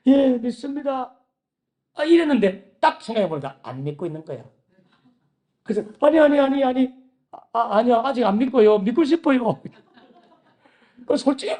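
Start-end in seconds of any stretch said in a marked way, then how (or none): 0.1-1.1 frightened reply. Retold or imitated. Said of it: "너무너무 무서워가지고". 6.0-9.6 higher and louder, tumbling no after no; loud frantic denial. Retold or imitated. Student hastily takes back his answer to God, loud and frantic. Panicked correction to God in prayer.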